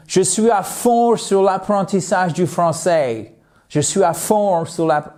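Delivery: a lightly put-on American accent speaking French; mock an American learner saying it in French, half-joking. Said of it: "mon accent pitoyable américain en français". (accent américain léger en français, pour rire)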